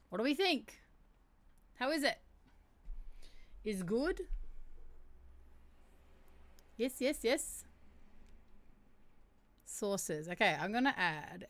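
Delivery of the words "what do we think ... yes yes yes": thrilled asking for approval; excited. Real, not acted. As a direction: bounce with excitement. Excited after reading.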